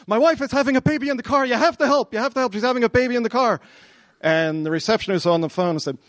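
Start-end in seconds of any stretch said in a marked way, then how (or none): 0.1-3.6 high, fast, panicked yell; frantic screaming. Retold or imitated. Husband to maternity receptionist, frantic yelling for help. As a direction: shout for help in a rush, acted out. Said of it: "started screaming".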